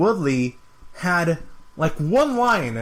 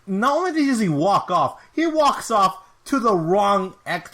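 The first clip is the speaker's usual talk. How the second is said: usual speaking voice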